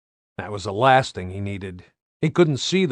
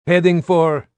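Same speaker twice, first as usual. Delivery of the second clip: radio voice; 6 semitones higher, 6 dB louder than usual. Acted. Radio call in battle.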